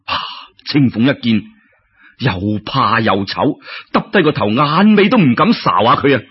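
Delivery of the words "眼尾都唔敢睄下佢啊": usual speaking voice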